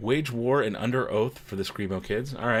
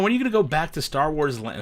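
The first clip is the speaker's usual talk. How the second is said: usual speaking voice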